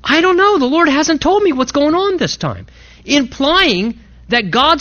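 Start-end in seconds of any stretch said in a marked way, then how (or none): 0.0-2.7 baffled. Retold or imitated. Elisha to the mother, raised high and baffled. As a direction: sound thrown by God's unusual silence. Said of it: "He says".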